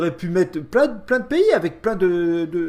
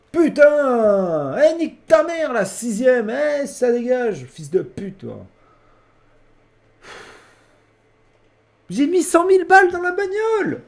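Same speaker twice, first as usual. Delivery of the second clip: raging gamer; 5 semitones higher than usual. Real, not acted. Cursing the game after losing.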